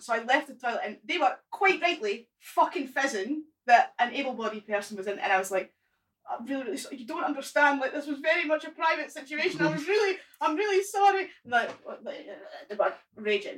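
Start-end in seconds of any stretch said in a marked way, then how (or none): 6.3-11.3 frantic apology. Retold or imitated. Apologising to angry wheelchair users.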